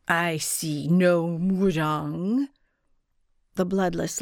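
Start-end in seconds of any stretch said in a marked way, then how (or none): 0.1-2.5 very slow, otherworldly guardian; eerie spirit voice. A narrator's reading. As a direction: speak like an ancient spirit.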